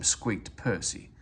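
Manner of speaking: ordinary delivery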